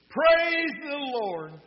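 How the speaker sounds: high, drawn-out call to heaven; elderly lady's ringing holler; loud praise. Acted. Lady praises before pleading.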